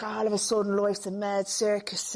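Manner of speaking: acted monologue in a Dublin accent; dramatic line in Dublin Irish. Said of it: "I was doing a Dublin accent".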